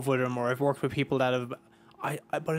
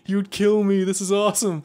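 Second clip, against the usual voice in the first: starstruck fan; 6 semitones higher, 6 dB louder than usual. Retold or imitated. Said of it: "And I was just like".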